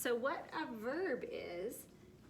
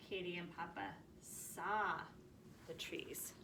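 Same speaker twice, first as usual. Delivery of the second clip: softer, fading to a whisper on the trees; soft reading; 3 semitones lower, 8 dB quieter than usual. Shown as a demonstration. Teacher rereading the past tense sentence softly. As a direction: read it softly, trailing into a whisper. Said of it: "change the word see".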